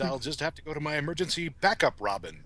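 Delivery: normal talking voice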